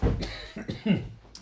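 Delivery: a dry, breathy coughing fit; real dry cough. Real, not acted. Host breaks off to the chat, real dry cough. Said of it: "I'm already starting to cough".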